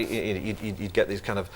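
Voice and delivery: usual speaking voice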